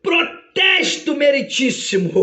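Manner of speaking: high, long-drawn courtroom objection; commanding, stretched-out proclamation; Jesus, mighty advocate. Acted. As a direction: let the objection ring out slowly and grandly. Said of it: "com aquela voz que é como o som de muitas águas".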